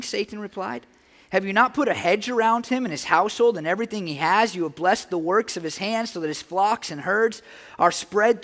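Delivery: a preacher reading Job aloud; scripture reading. Acted. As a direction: proclaim the verses to the congregation.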